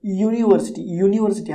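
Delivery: यूनिवर्सिटी starting with a य glide; यूनिवर्सिटी begun "yoo", not a bare vowel; initial Y sound. Shown as a demonstration. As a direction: open यूनिवर्सिटी on a consonant y. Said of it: "यानी कि य".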